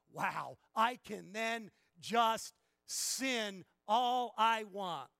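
slow, loud mocking voice of someone who wants to sin freely; mock careless sinner. Preacher mocking people who twist grace into license, slow loud mimicry. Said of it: "the people who say".

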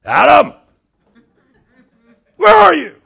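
very loud, high-pitched bellow; angry yell. Shown as a demonstration. How we misread God calling Adam, furious shout. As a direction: roar it like a father yelling at his child. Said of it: "put the intonation".

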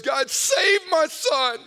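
imitating a father's anguished, shouted plea; crying out. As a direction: shout the prayer high and loud, begging for your son. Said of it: "he started crying out on behalf of our family".

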